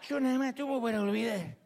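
imitación leve de un ebrio; niégalo como un borracho; borracho que lo niega. Acted. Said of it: "Cómo se manda a un ebrio". (borracho excusándose, apenas actuado)

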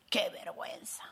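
hushed, low whisper of embarrassment; whispered shame. Retold or imitated. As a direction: breathe it out ashamed. Mock-ashamed exclamation, whispered.

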